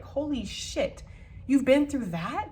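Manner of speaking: slow, high, stunned disbelief; dumbfounded. Acted. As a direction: draw it out in acted amazement, rising at the end. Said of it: "They would be just dumbfounded".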